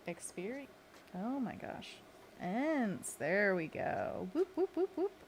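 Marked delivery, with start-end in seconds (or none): 4.3-5.1 high, bouncy celebratory whoops; happy whoops. Real, not acted.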